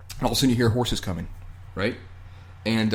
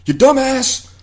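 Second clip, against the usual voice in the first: high, loud mock outburst; mock scolding; 9 semitones higher, 5 dB louder than usual. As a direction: scold him as the exasperated blacksmith. Blacksmith scolds the stung one.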